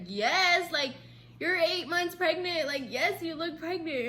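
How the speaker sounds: loud, high, exasperated; emphatic confirmation. Retold or imitated. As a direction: insist as if it is obvious. Exasperated boyfriend reassuring her.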